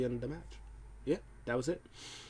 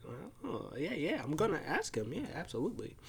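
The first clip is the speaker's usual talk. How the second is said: happy, retold; 3 semitones higher, 10 dB quieter than usual. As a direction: lightly imitate a delighted interviewer. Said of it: "Kayla gets happy when she hears that".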